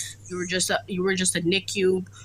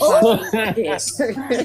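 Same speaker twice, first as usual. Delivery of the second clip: laughing; 5 semitones higher, 6 dB louder, much slower than usual. Real, not acted. Laughing at the irony.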